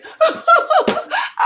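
very high-pitched hooting laugh; high "hoo-hoo" giggle. Real, not acted. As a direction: laugh in a string of high hoots. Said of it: "hear me laughing like that".

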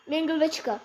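ordinary talk

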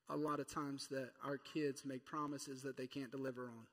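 usual speaking voice

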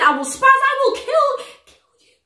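acting out the rapper's threat, half-hushed at the end; mock rapper threat. Reaction vlogger quoting the diss, mock menacing. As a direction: pitch jumps up, then a breathy unvoiced "kill you". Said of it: "He said".

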